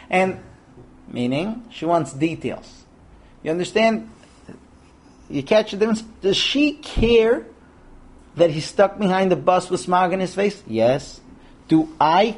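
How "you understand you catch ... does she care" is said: ordinary talk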